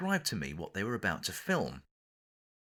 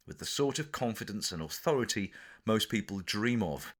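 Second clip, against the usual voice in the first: plain reading voice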